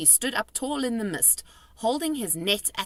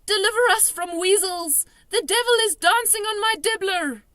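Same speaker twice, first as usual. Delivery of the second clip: anguished cry; 14 semitones higher, 8 dB louder than usual. A narrator's reading. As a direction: wail in distress. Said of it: "he cried".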